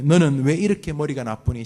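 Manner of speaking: berating parent. Acted. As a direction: belittle the child's brains. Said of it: "결점 비난이". (아이를 깎아내리는 꾸중)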